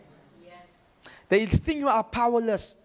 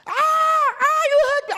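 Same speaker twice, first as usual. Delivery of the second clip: high shrieking demon cry; demon in pain; 6 dB louder than usual. Retold or imitated. Demon crying out as the preacher drives it out.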